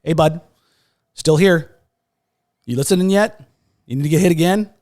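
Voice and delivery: high, loud, needling questions; God's nudging voice. Retold or imitated. God prodding a broken man who is not listening, loud nudging. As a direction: call out pointedly, pausing between each prod. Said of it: "It was like God was going".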